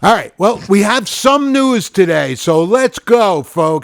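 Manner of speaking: high, loud, hammed-up headline read; over-the-top showman news intro; news announcer. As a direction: boom out the headline, stretching the words.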